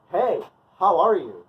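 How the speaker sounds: loud, drawn-out, over-friendly hello; hammed-up oblivious hello; crazy stupid greeting. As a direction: stretch out an exaggerated cheery greeting. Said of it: "All crazy stupid".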